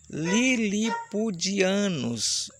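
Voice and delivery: Lilipudianos stretched slowly, syllable by syllable. Shown as a demonstration. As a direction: spell Lilipudianos out slowly for the kids. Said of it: "Vamos falar vagarosamente".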